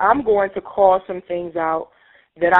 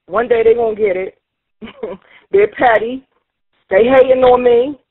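louder, rising frustrated outburst; angry venting; 3 semitones higher, 4 dB louder than usual. Acted. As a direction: spit out the anger. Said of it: "I'm gonna express the pain".

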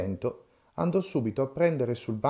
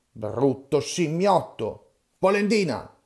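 shouted insults; 4 semitones higher, 6 dB louder, slower than usual. Acted.

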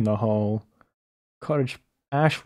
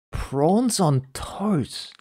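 disbelief; 8 semitones higher, much slower than usual. Real, not acted. Reacting to odd menu titles.

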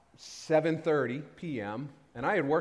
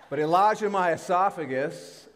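emphatic; 6 semitones higher, 8 dB louder than usual. Real, not acted. Resumes the choking story louder.

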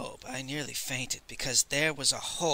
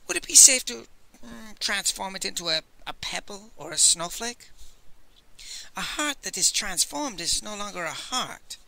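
higher, louder, mocking old man; scornful old scholar; 7 semitones higher, 3 dB louder than usual. A narrator's reading. Master Li scoffs at the Old Man, high and scornful. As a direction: laugh off the offer as nonsense.

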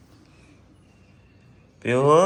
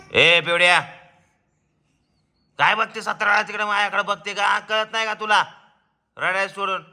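higher, louder teasing scold; mock scolding; 8 semitones higher, 4 dB louder than usual. Shown as a demonstration. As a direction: show her how to yell at her sister. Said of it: "मोठ्याने आवाज काढायचा असा".

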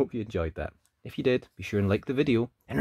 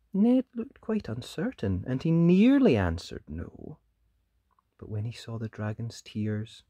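plain reading voice

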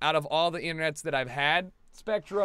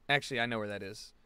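ordinary talk

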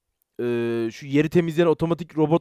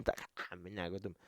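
muttered threat; 12 semitones lower, 22 dB quieter, slower than usual. Retold or imitated. Imagined fight, muttered aside.

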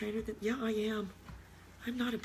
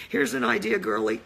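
sneering put-down; 10 semitones lower, 8 dB louder than usual. Real, not acted. Sneering at webinar girl.

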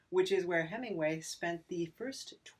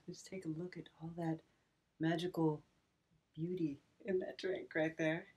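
hushed whisper; 10 dB quieter than usual. Acted. Whispering over the pretty drink.

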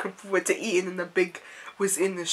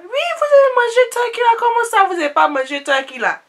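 high, loud and fast Ivorian auntie; 9 dB louder, faster than usual. Retold or imitated. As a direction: rattle it off high and insistent. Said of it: "She's like".

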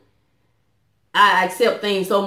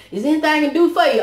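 mock polite offer; 6 semitones higher, faster than usual. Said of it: "people that be like".

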